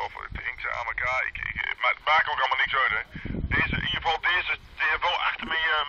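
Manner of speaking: ordinary talk, nothing marked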